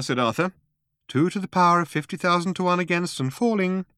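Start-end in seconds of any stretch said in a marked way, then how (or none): 1.1-3.9 higher, composed announcing voice; sensible girl's voice. A narrator's reading. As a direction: read out the odds sensibly, pitched up. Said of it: "said the voice".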